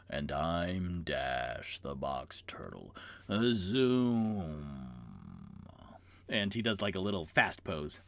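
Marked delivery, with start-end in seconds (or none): 0.1-4.9 much lower, soft, very slow turtle; sleepy slow turtle. Acted. Turtle introduces itself.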